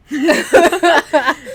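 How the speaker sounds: loud, high laugh; burst of laughter. Real, not acted. Host cracks up at the page she opened, loud laugh. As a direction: break up laughing, loudly.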